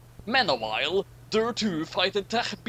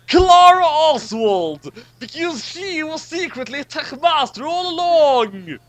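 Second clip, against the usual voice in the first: dramatic reveal; 11 semitones higher, 8 dB louder than usual. Acted. Narrator reveals the secret villain with big drama. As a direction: announce the villain like a shock ending.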